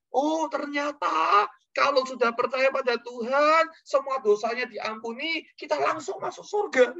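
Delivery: high-pitched, slow exclamation of discovery; imitated joyful cry. Imitating an old man grasping salvation, crying out. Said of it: "tiba-tiba si omnya teriak".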